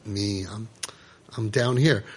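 quiet, low, defeated reply; dejected. Acted. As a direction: mumble it as if beaten. Voiced dejected self.

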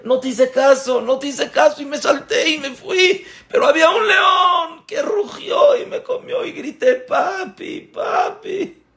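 put-on very high, wailing child voice; hammed-up child's tearful complaint; distraught little boy. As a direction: climb into a crying shout as you recount it. Boy wails his ordeal to father.

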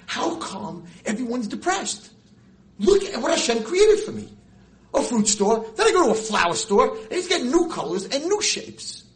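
loud, high, slow awe; overflowing delight at creation; ecstatic wonder. Retold or imitated. Rabbi walking into the flower store, loud and awestruck.

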